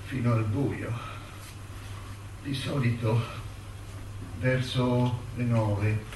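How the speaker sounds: measured, sombre letter read as a voiceover. Acted. Prisoner writing to his mother from his cell, sombre reading.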